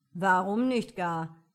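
slow, loud lazy fool. A narrator's reading. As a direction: drawl it out like a lazy peasant. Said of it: "sagte Emelian".

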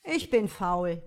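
loud, lazy fool. A narrator's reading. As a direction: shrug it off like a lazy peasant. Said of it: "der Narr sagte".

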